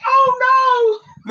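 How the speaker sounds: high-pitched comic "uh-oh" feel; mock alarm. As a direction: act out dismay in a high voice. Retells own alarm, comic.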